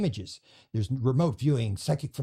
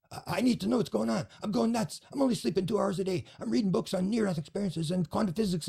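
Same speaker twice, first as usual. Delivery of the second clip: higher, hurried and frantic; 7 semitones higher than usual. Retold or imitated. Frantic caller telling him he can't sleep, pitched high. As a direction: rattle it off like you haven't slept. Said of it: "talking a mile a minute".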